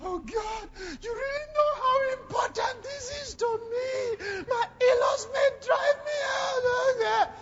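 high, far-off mock sobbing plea; mock wailing prayer. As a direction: ham up a weeping woman begging God. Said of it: "They didn't go".

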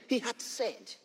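hushed, private voice; quietly spoken. Shown as a demonstration. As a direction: say it quietly, as in the heart. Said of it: "he may say it in your heart quietly".

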